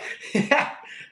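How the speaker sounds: airy wheezing giggle; breathless laugh. Real, not acted. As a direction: crack up silently at the joke. Amused by Farquaad comment.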